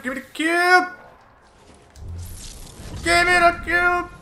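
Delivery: urgent shout. Real, not acted. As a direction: yell it at your teammate. Demanding cube louder.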